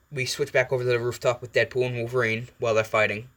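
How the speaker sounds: plain reading voice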